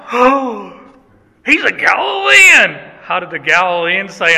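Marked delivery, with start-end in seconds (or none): 0.1-2.9 gasp, then loud high-pitched exclamation; acted-out eureka moment, bursting out; dawning realization. Doorkeeper suddenly placing Peter's accent, in a loud gasp of discovery.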